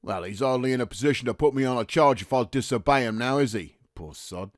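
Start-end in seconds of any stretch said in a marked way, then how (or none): 3.9-4.5 low, very soft afterthought; muttered aside. Acted. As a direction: say it half to yourself. Private mutters about the captain.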